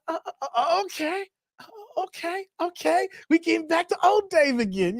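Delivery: high, hesitant okays building to confidence; pleasantly surprised self. Retold or imitated. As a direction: imitate tentative, rising self-approval. Acts out noticing his abs return.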